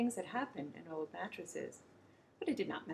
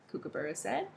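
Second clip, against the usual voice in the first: usual speaking voice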